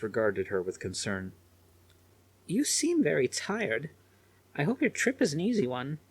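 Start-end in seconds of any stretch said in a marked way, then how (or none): 2.5-6.0 lightly voiced concerned old lady. A narrator's reading.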